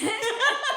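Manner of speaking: high laugh. Real, not acted. Laughing after impression.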